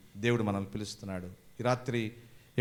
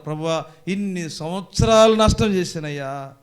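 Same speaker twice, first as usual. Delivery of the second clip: loud mock-weeping plea; imitated tearful prayer; 4 semitones higher, 7 dB louder than usual. Congregation crying to the Lord over wasted years, imitated wail. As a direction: imitate a congregant's lament, raised voice. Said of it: "అని వచ్చి ఇక్కడ రేపు ఏడుస్తాం".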